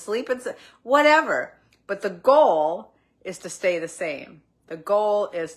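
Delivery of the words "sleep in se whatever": high, loud brush-off; exasperated. Real, not acted. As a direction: toss it away with a loud shrug. Dismissive aside about separate beds.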